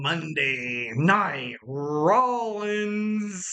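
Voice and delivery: slow, swooping show intro; drawn-out announcement. Real, not acted. Host introducing tonight's wrestling topic, drawn-out announcement. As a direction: stretch every word like a ring announcer.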